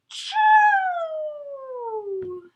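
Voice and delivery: high, loud sneeze gasp into chew; big sneeze build-up. Acted. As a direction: act out a huge sneeze.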